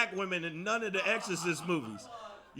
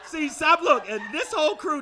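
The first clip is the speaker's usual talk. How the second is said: ordinary talk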